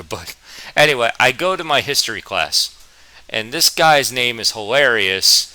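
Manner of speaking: ordinary talk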